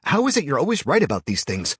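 loud, high and delighted; excited. A narrator's reading. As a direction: marvel at him, loudly. Eric cheering after the fight.

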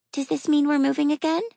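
put-on high, fretful little girl; anxious child voice. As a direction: ask like a worried kid. Child fears moving.

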